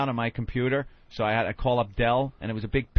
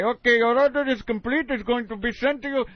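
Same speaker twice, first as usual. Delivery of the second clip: mock Indian phone rep; 8 semitones higher, 3 dB louder than usual. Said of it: "if I heard this guy".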